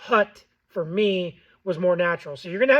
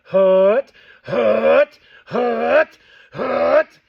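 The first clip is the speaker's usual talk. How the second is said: loud hut, pushed from the chest; voice inflection on hut; 4 semitones higher, 10 dB louder, much slower than usual. Shown as a demonstration. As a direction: hit each hut with a firm grunt-like push. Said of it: "bringing your voice down naturally".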